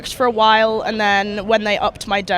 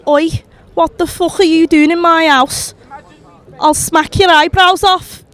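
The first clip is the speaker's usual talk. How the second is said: higher and louder Liverpool shout, threatening an intruder; angry Scouse ghost; 7 semitones higher, 7 dB louder than usual. Pretend. Asked for an impression, [name]'s Liverpool ghost shouts at a trespasser. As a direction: bellow it like a furious Scouse ghost. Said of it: "Can you do an impression of a ghost from Liverpool".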